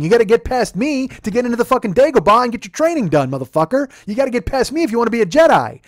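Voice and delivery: loud, high, indignant tirade; heated, shouted comic outburst; worked-up rant. Retold or imitated. Worked-up rant about guarding his building.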